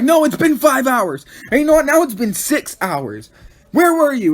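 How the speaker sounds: loud, high mock yelling boss. [name] yelling at her friend for vanishing, mock angry yelling.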